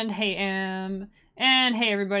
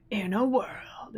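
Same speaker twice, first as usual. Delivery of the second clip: slow movie-trailer opener; lightly voiced epic trailer announcer; trailer guy voice; slower than usual.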